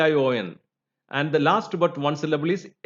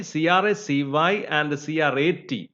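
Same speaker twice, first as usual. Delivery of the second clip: ordinary delivery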